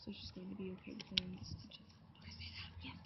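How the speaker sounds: unvoiced prompt whisper. Real, not acted. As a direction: whisper the line as a cue.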